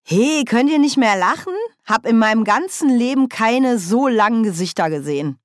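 laute, höhere Figurenstimme; Nanny Ogg, ausgelassen. A narrator's reading. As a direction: munter die missmutige Runde aufrütteln. (laut und hoch, fröhlich tadelnd)

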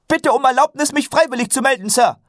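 very high, loud military request; keen saluting recruit. A narrator's reading. Recruit volunteers to his sergeant, loud eager military report. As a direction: report for duty with crisp, eager volume. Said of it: "Karotte salutierte zackig".

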